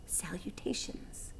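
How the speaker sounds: soft slow whisper from the dark; whispered greeting. A narrator's reading. Hidden spider whispering to the pig. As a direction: breathe the word out like a secret. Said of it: "said the voice".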